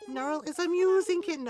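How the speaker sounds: high and loud kitten voice; high cute kitten voice. Acted. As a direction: squeak it like a kitten. Reads Lolly's catchphrase.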